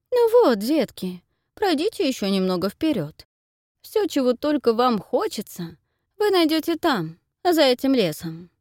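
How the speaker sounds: high, sly wicked stepmother; man doing a woman's voice. A narrator's reading. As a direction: coax the children falsely. Man plays wicked mother.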